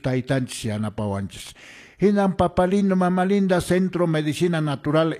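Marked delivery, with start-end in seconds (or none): 2.0-5.2 higher and louder, hailing the listeners; loud radio call-out. Acted. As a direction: call out to everyone like a radio host. Radio healer calls out to listeners.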